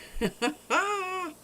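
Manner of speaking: a bright high-pitched laugh; high giggle. Real, not acted. Delighted by her fish, high giggle.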